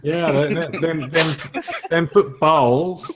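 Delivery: hammed-up southern drawl, slow; lightly put-on southern accent; mock southern accent. Host joking about football in a southern accent. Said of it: "you gotta get the southern accent".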